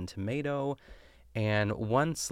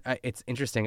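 ordinary talk, nothing marked

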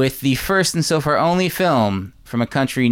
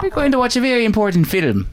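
mock Irish accent pitched up; hammed-up Irish accent; 10 semitones higher than usual. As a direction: lay on a cartoon Irish brogue. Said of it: "do that again".

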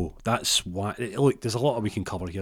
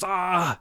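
tasered yelp; 11 semitones higher than usual. Retold or imitated. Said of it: "He goes".

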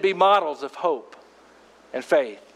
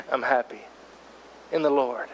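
laughing through real tears; choked-up happy laugh, then softer; teary laugh; 3 semitones lower, 6 dB quieter than usual. Real, not acted. Pastor to congregation, tearing up with a laugh. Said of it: "even though I'm tearing up".

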